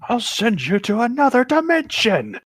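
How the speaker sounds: slow, high, hammed-up villain line; mock villain threat. Joking dramatic threat.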